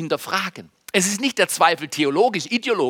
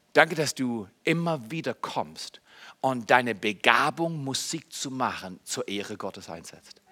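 soft, much quieter, heartfelt thanks; sincere thanks; 7 semitones lower, 7 dB quieter than usual. Real, not acted. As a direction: speak gently, straight from the heart.

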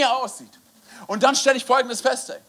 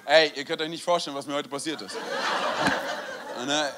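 imagined technician; 7 semitones lower, 6 dB quieter than usual. Retold or imitated. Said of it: "Der ist bestimmt so".

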